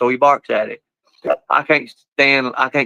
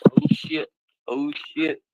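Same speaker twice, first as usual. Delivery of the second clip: under-breath alarm; 8 dB quieter, slower than usual. Real, not acted. Dogs about to fight.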